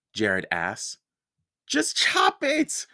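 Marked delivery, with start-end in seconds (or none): 1.7-2.9 put-on higher, slow, tearful little-girl plea; a hint of tears as she begs; mild sobbing plea. A narrator's reading. Mallory, tied to the bed, begs with a touch of sobbing. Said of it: "Mallory sobbed".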